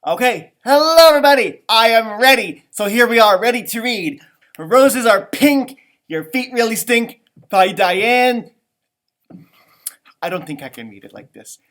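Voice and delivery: usual speaking voice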